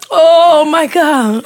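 drawn-out oh my God through laughter; cracking up, barely getting it out; laughing. Real, not acted. Cohost's joke has her cracking up, laughing.

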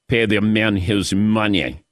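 low, slow mock Russian accent; Teddy KGB's Russian accent. Host quotes the poker movie's losing villain, mock Russian accent. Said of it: "Teddy K G B and his Oreos".

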